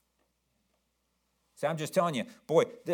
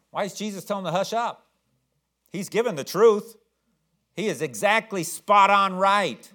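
ordinary delivery

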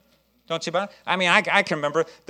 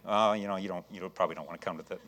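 very low, soft, defeated mumble; put-on unenthusiastic, apologetic invitation; mock reluctant invite; 8 semitones lower, 7 dB quieter than usual. Shown as a demonstration. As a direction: mimic someone inviting with no hope. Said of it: "You're not just".

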